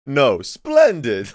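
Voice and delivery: loud, slow, drawn-out mimic of the chirpy program; mock cheery computer voice. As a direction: parrot the software's "splendid" in disbelief. Mocking the software's cheery reply.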